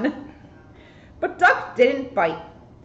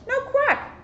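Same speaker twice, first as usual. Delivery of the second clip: character voice. Acted. Character voice in story.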